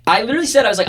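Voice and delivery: ordinary talk, nothing marked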